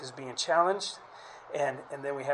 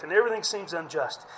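normal talking voice

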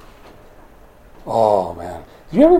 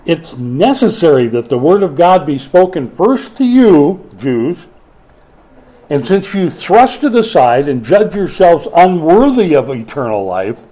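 louder, a firm public rebuke; read loudly; 4 semitones higher, 6 dB louder than usual. Acted. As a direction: proclaim it like Paul confronting the crowd. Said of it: "Paul and Barnabas replied loudly".

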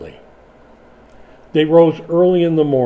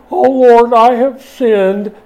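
high, loud, slow fake-sobbing televangelist; mock crocodile tears; 8 semitones higher, 7 dB louder, slower than usual. Mocking a televangelist's fake TV repentance, high wailing plea. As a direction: ham up a fake repentant wail. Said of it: "big crocodile tears flowing".

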